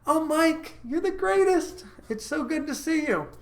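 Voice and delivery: mock-excited sweetheart greeting her man; gushing girlfriend. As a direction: gush over Mike like a thrilled girlfriend. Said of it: "she's gonna be like".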